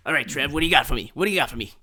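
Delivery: lightly voiced brisk executive; a hint of a quick-talking executive; studio boss persona. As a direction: play the boss demanding a pitch. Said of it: "my executive, uh, persona".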